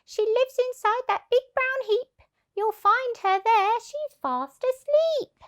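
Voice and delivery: high chirpy voice; helpful hen. Acted. Hen luring the thieves, high and chirpy. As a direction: chip in brightly. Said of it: "chipped in the hen".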